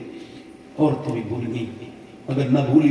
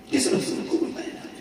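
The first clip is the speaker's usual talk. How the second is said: hushed whisper of the question; whispered rebuke; 8 dB quieter than usual. Real, not acted. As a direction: drop to a whisper to sting them. Same question repeated hushed.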